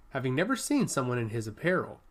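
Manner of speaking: plain reading voice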